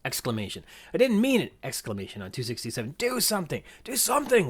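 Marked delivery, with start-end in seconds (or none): 1.0-1.5 put-on high, louder kid's protest; mock exclaiming. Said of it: "they exclaim way more". 3.0-4.5 Lex. Acted. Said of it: "Lex is wailing, screaming for her life".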